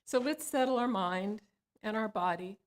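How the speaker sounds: calm, unhurried meditation voice with long pauses; guided meditation. Acted. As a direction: ease listeners into stillness, phrase by phrase.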